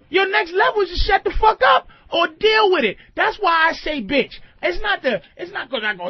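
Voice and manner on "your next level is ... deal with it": raised, higher mock shout; mock yell. Telling off a woman in a retold argument, a loud shout. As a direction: bark it back at her.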